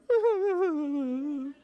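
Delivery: high, loud wordless wail; mock crying. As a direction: imitate helpless sobbing out loud. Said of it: "a man following her crying".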